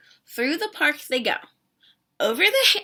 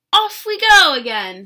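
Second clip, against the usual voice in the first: much louder cheerful call; 9 dB louder than usual. A narrator's reading. Bird calls out as they head home, loud. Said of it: "calls Bird".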